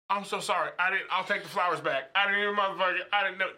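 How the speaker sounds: high, loud frantic apologizing; frantic apology. Retold or imitated. Boyfriend panicking over her tears, high loud apology. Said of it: "and I'm like".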